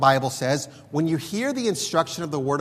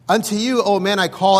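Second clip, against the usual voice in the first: raised, higher calling voice; crying out; 4 semitones higher, 7 dB louder than usual. Acted. Preacher quoting wisdom at the gates, crying out. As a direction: call out loudly to a crowd. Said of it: "again, crying at the gates".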